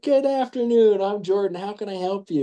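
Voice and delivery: high, sugary customer-service greeting; high-pitched helpful-salesman voice; killing with kindness. Retold or imitated. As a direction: greet a hostile customer with over-sweet politeness. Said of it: "trying to kill her with kindness".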